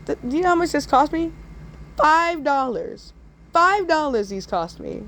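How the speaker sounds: high indignant whine about the price; mock-offended "Five dollars"; outraged complaint. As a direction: act shocked at how much it cost. Outraged over pencil price.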